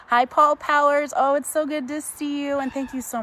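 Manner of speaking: high, loud delighted hello; excited greeting. Real, not acted. Host greeting a donor on the stream, excited greeting.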